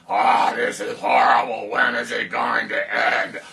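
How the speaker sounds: loud groaning complaint; hammed-up despairing shout; mock despair. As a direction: wail about how bad it is. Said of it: "it's just all not like".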